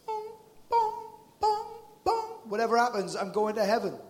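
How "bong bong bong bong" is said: high sung bong like a beacon; homing beacon bong. Retold or imitated. Preacher imitates his homing beacon, high bong. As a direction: ring out like a beacon. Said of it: "like my little homing beacon".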